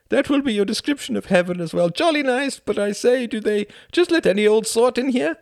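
high, loud, hammed-up posh Englishman; mock English toff. Klaus teasing Tom with a mock English gent voice. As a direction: send up the stiff-upper-lip English.

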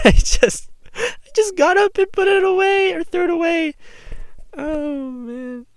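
high laughing words. Real, not acted. Laughing at own story.

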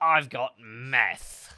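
slow, drawn-out imitation; mock proud yell. Co-host repeating the festival-goer's line, drawn-out mock yell. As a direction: stretch it out in a mock boast.

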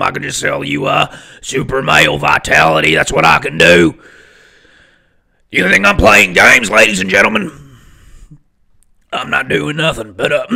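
loud, forceful ranting showman voice; Alex Jones. Acted. Podcaster claims he can go forever, loud ranting impression. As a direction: rant at full volume like a radio host. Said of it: "I'm like Alex Jones as well".

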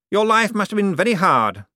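condescending lord to servant; Cosmo's voice. Acted. To his butler, Cosmo's lofty patronizing voice.

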